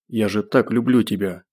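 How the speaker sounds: ordinary delivery, nothing marked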